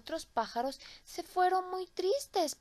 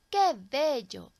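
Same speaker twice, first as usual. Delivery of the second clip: delighted; 3 semitones higher, 8 dB louder, slower than usual. A narrator's reading. Narrator marvels at the tree.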